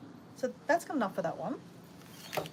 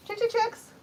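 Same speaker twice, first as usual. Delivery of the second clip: chicken call; 3 dB louder than usual. Real, not acted. Calling the chickens.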